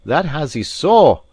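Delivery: high, loud, slow exclamation; emphatic cry. A narrator's reading. Alan answers the maid asking about friends, crying out. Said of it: "cried Alan".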